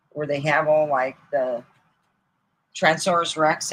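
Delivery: normal talking voice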